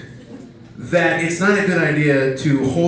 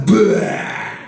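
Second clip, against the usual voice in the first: mock puke sound; 5 semitones higher than usual. Imitating baby puking in mouth.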